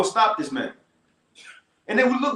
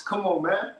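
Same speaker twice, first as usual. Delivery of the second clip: normal talking voice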